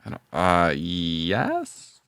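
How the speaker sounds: normal talking voice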